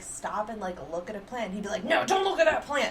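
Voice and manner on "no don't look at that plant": loud, high mock yell; over-the-top telling-off, pitched up; exaggerated scolding. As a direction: imitate a partner scolding, hammed-up. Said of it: "Not like that, but that's how it felt".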